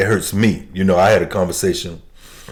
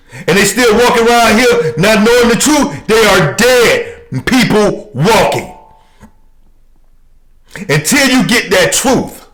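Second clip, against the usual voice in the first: raised, much higher preaching voice; fiery preaching; 11 semitones higher than usual. Acted. Preacher to congregation, fiery raised preaching. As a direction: thunder it from the pulpit.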